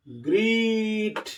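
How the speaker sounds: "গ্রিট" stretched very slowly on a high held vowel; drawn-out pronunciation demo. Shown as a demonstration. Teacher sounds out the spelling for pupils, "গ্রিট" slowly stretched. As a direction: hold the long ee in "গ্রিট", then close on t. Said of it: "উচ্চারণ দেখো".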